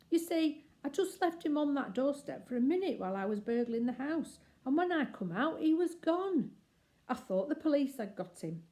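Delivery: a lady burglar delighted to recognise Bill; Burglar Betty. Acted. Betty voiced by narrator.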